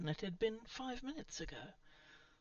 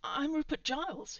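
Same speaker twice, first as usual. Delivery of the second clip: higher, louder, alarmed self-introduction; urgent, raised voice under threat; urgent protest; 9 semitones higher, 7 dB louder than usual. A narrator's reading. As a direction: blurt out who you are. Pleading at crossbow point.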